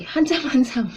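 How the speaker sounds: Handsome, handsome answered high with a laugh; laughing reply. Shown as a demonstration. Teacher shows the laughing reply to a compliment. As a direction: tease back playfully. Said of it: "then you will say".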